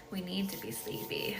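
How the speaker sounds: hushed, breathy whisper. Real, not acted. Healer hushing her listeners toward sleep, whispering. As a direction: whisper it like a lullaby.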